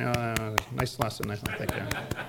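soft, trailing polite murmur, fading to a breathy near whisper; imitating listeners who thank him half-heartedly; mock polite praise. Listeners thanking the teacher after a long lesson, mock polite murmur. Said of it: "And people say".

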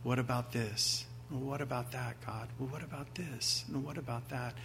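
softly fretting worrier asking God; worried fretting. Retold or imitated. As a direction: fret quietly to yourself. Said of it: "Do not worry, saying".

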